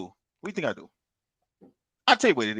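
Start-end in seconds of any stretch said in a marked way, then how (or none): 0.0-0.9 quieter teasing repeat. Shown as a demonstration.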